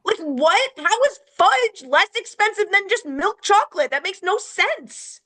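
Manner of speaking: high-pitched indignant rant at the brand; outraged rant. Real, not acted. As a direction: demand answers from the company in disbelief. Ranting at the chocolate company.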